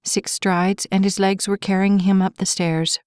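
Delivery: ordinary delivery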